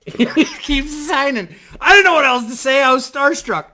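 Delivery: high-pitched, amused protest through laughter; laughing while talking. Real, not acted. Storyteller on his starstruck blunder, laughing high. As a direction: laugh helplessly at your own awkwardness.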